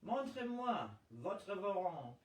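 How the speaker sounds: a lady struggling with her French; halting French. A narrator's reading. A woman demands a warrant in halting French. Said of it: "Hugo's vocabulary failed her".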